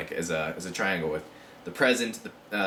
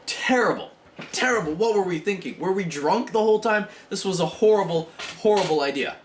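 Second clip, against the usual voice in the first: mock dismay; 9 semitones higher than usual. Humanity looking back in dismay.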